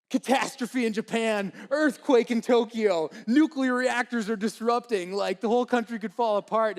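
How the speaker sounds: loud, high alarm newscaster; a newscaster blasting catastrophe news; dramatic news headline. Retold or imitated. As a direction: announce doom like breaking news. TV news blaring.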